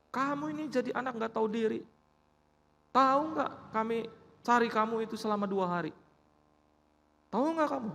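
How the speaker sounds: put-on sharp, high-pitched scolding of a runaway child; angry scolding parent. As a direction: scold the child like a furious father.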